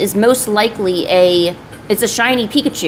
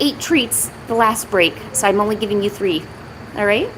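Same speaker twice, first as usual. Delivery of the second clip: usual speaking voice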